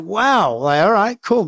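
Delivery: high startled wow. Retold or imitated. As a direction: caught off guard, nervous agreement. Reacting to threat of punch.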